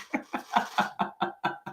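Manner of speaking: soft, breath-only chuckle trailing on; laugh. Real, not acted. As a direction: let the laugh run out on breath. Laughs at the software failing.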